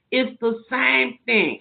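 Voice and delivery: It's the same thing, said slowly and settled; slow it down to drive it home; slow repeat. Real, not acted. Second take, slower.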